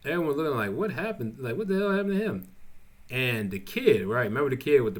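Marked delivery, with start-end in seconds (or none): 0.7-2.5 high, fast mock astonishment; shocked kids. Schoolkids seeing weak Trevor, in high, fast mock astonishment. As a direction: rattle it off high, like stunned onlookers.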